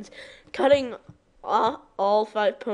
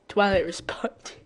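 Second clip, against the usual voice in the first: usual speaking voice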